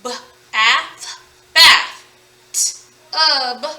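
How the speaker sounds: B, A, TH separately, then blended bath; separated phonics sounds, T then UB; sounded out. Shown as a demonstration. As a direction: tap each sound alone with gaps. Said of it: "each individual sound".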